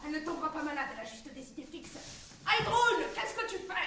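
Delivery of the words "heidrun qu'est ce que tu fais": loud, raised-pitch call across the garden. Acted. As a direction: shout to her outside. Appelle Heidrun dans le jardin. (interpellation criée de loin)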